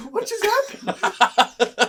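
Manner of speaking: very high, loud, drawn-out disbelief; slow, high-pitched shocked outburst; mock bewildered cry. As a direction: imitate a stunned yelp through laughter. Acting out Garrett's shock at missing box.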